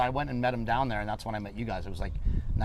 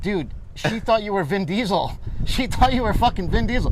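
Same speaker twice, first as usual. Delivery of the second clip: laughing, rising louder taunt; laughing tease; 7 semitones higher, 6 dB louder than usual. Retold or imitated. Friends ribbing The Rock over Vin Diesel, laughing taunt. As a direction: crack up while ribbing him.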